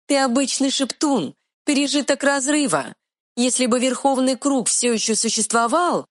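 high, quick fairy voice; tiny fairy retort. Acted. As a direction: snap back like a tiny fairy. Fairy speaks.

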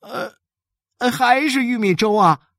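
high-pitched whine of disappointment; hesitant start, then a let-down kid's complaint; disappointed child. A narrator's reading. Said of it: "一乐失望地说".

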